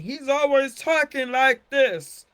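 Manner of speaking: animated voice demo. Shown as a demonstration. Teacher showing a student's animated voice. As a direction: show how the child sounds, lively and big. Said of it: "should talk like this".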